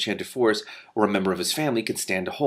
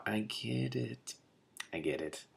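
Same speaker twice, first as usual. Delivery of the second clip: quiet realization; 14 dB quieter, much slower than usual. Real, not acted. Reader's aside on the elements.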